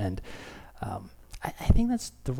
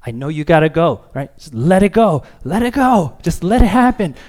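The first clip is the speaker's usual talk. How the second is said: higher, louder, pleading coax; coaxing dad; 7 semitones higher, 8 dB louder than usual. Retold or imitated. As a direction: urge a toddler to just let go. Dad coaxing toddler on toilet.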